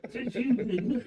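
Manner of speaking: laughing while teasing. Real, not acted. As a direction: get it out through laughter.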